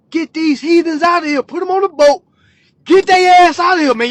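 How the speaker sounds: worked-up, high and loud demand; egging them on. Acted. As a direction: holler it like you're riling up the room. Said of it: "like, egging that shit on".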